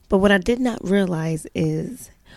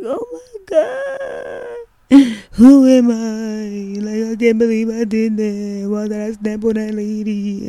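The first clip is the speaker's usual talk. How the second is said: high, slow, sobbing wail in the prayer closet; ugly crying; 4 semitones higher, 6 dB louder, slower than usual. Retold or imitated. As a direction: cry out the words through tears, broken and pleading. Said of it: "I'm all crying".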